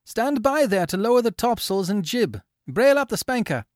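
high, loud command across the deck; shouted order. A narrator's reading. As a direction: bark the order to the crew. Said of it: "the young man shouted".

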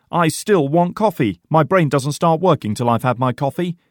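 high, loud, insistent complaint; stubborn. A narrator's reading. Porter insists on his breakfast coffee, stubborn protest. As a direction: hold out and protest. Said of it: "says Porter stubbornly".

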